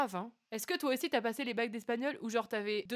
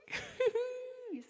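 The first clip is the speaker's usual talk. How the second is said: laughing thanks; 13 semitones higher, 6 dB quieter than usual. Real, not acted. Shy thanks after song.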